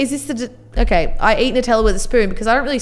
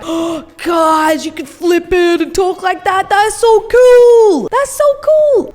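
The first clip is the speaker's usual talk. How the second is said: put-on high, loud, giddy thirteen-year-old gushing; hammed-up teen excitement, much higher and louder; excited kid; 6 semitones higher, 4 dB louder, slower than usual. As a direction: gush like a kid with a new phone. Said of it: "it was like".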